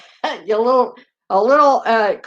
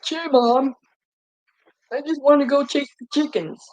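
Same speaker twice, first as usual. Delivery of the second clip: lightly voiced little kid replying to mom; a hint of a pleading toddler, slightly raised; two-year-old answering; 4 semitones higher than usual. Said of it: "like he's a two-year-old, expecting him to answer".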